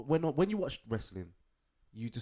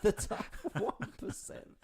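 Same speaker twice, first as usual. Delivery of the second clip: mock echo; slower than usual. Mocking echo of friend.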